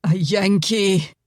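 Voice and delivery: slow despairing "A Yankee," falling away; slow moaned "A Yankee,"; moaning. Acted. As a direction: groan "A Yankee," in defeat, slowly. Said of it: "Angus moaned".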